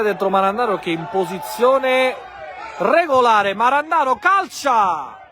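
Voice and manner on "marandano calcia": ordinary talk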